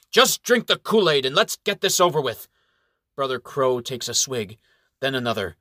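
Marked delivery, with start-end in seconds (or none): 0.1-2.5 loud, impatient shout, pitched up; an exasperated knight yelling. A narrator's reading. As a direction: bellow at them to just drink it. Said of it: "Sir Robert Roger shouts".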